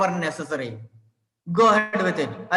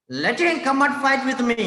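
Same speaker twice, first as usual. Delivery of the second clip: exclaimed challenge; 7 semitones higher than usual. Shown as a demonstration. Teacher reading Goliath's dare, exclaimed. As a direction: read it out as a bold dare. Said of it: "a sentence that shows the exclamation".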